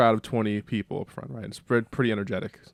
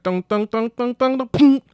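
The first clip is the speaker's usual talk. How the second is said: high sung guitar plucks; 13 semitones higher, 7 dB louder than usual. Retold or imitated. Tuning guitar, vocalised.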